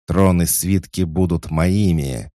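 loud greedy battle cry; booming triumphant roar. A narrator's reading. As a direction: bellow it like a conqueror. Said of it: "вторил ему другой голос".

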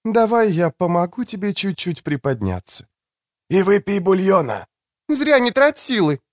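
a nurse tending a patient. A narrator's reading. Soulcatcher voiced caring.

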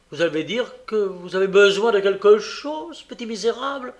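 high, loud, indignant outburst; angry scolding. Acted. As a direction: berate the child, pitch climbing. Woman cuts in, scolding the boy. (réprimande indignée, forte et aiguë)

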